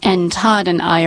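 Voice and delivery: ordinary delivery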